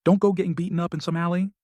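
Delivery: advising. A narrator's reading. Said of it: "speaks up to advise".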